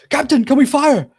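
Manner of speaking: a deckhand calling out for orders to fire; eager crew shout. Acted. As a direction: shout up to the captain. Crewman calls to captain in battle.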